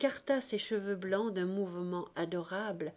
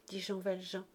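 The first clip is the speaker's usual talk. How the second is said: ordinary delivery, nothing marked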